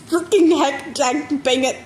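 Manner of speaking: hammed-up pained swearing; garbled pained cursing. A narrator's reading. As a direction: sputter censored swears while hurting. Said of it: "dissolved into a string of half-garbled profanities".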